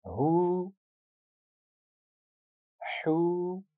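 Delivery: हू held long, the two h sounds kept apart; two distinct long hu. Shown as a demonstration. Teacher contrasts two h letters for the class: long हू. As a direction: stretch each हू and keep the h sounds distinct. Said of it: "एक दफ़ा फिर पढ़िए".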